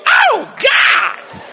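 high, loud mock disgust; disgusted groan. [name] reacting to burps in the back, loud disgust. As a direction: cry out at the smell.

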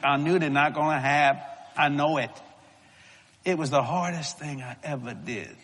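ordinary talk, nothing marked

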